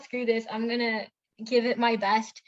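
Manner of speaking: ordinary talk, nothing marked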